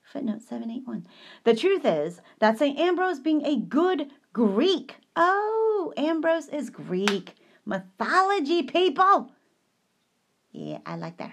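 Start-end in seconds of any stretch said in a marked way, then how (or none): 1.4-9.3 excited. Real, not acted. Excited aside about Greek mythology.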